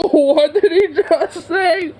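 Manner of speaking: high, slow, laughing in disbelief. Real, not acted. Reacting to the clip, slow high laughing disbelief. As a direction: gasp it out between laughs, stunned.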